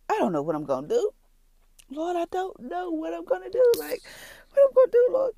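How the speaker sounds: high, tearful plea to the Lord; crying. Real, not acted. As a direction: break into real sobbing as you plead. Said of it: "excuse me for crying".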